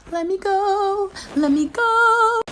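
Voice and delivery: high, very slow whiny plea; mock panicked pleading. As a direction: beg to be let go, helpless. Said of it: "I was just sitting there like".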